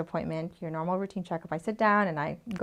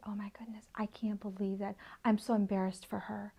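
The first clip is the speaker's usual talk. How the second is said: hushed gossip; 7 dB quieter than usual. Acted. Quoting mocking online commenters.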